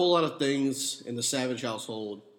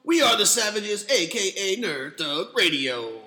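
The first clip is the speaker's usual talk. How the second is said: announcer voice; 6 semitones higher, 5 dB louder than usual. Pretend. Show intro, announcer.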